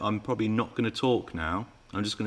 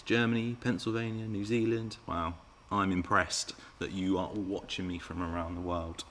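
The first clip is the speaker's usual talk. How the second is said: ordinary delivery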